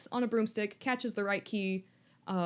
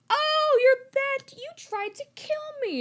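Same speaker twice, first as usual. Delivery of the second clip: squeaky, louder, startled little Harry; high mock Harry; 4 dB louder, slower than usual. Harry squeaks at Voldemort in mock alarm. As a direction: pitch it way up like a scared kid.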